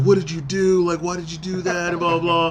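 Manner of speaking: lightly acted scared questioning; imitated fearful quivering. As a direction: plead like the cornered little guy. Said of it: "like, quivering".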